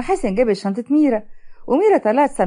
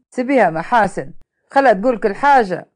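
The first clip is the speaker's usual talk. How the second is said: ordinary delivery, nothing marked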